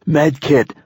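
high, urgent one-word shout; shouted order. A narrator's reading. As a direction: call for emergency supplies. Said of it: "I shouted".

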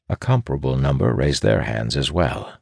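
plain reading voice

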